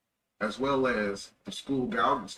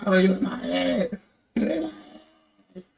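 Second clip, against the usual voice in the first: mock crying; 4 semitones higher, 5 dB louder than usual. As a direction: imitate a child bawling over a hurt head. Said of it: "Then I was crying".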